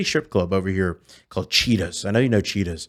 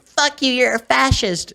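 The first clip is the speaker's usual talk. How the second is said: high-pitched mock screech of an outraged woman; heckling woman; slower than usual. Lady heckling a Trump impersonator on parade, mock screech. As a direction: imitate a shrill-pitched angry heckler. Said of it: "she just kept screaming".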